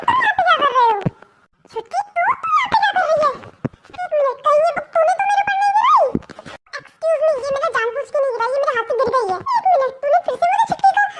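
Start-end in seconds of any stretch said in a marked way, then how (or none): 0.1-6.1 angry shout. Acted. Siblings quarrel over dropped book. 9.6-11.1 indignant shout. Acted. Angry at being called chhutki.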